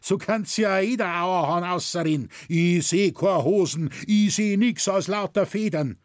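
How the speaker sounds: loud poacher, pitched well up; shouting. A narrator's reading. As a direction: shout him down in dialect. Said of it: "schrie der Wilderer".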